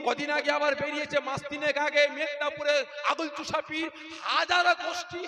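loud, high fiery sermon; rally the crowd, listing sects; full-force preaching. Real, not acted. Preacher rallying the congregation against division, full-force preaching.

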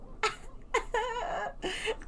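high sobbing laugh; soft wailing laugh; high crying laugh. Real, not acted.

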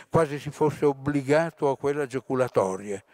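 usual speaking voice

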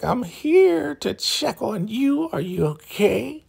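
slow, high singsong imitation of the elder; hammed-up, sugary-fake check-in; mock concerned visitor. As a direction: draw it out in a put-on falsetto. Imitates Elder Mother checking on victim.

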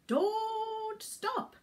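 a light cheer to keep pushing; cried out. Acted. Mr Gumpy urging the animals on, a mild high cry. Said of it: "cried Mister Gumpy".

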